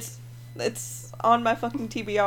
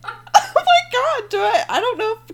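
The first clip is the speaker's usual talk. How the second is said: shocked laughing; 12 semitones higher than usual. Real, not acted. Hearing her co-host's brother is in prison, shocked laughing. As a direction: gasp it out, laughing.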